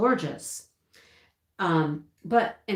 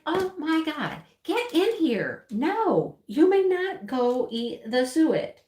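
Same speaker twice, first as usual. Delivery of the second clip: exasperated higher-pitched telling-off; mock scolding; 7 semitones higher, slower than usual. Owner to dog eating old suet, exasperated scolding. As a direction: order the dog in, stretching out the words.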